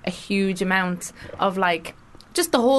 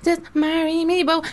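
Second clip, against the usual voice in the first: higher, begging plea; pleading swoon; 8 semitones higher than usual. Pretend. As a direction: beg him, half joking. Repeated plea, pitched up.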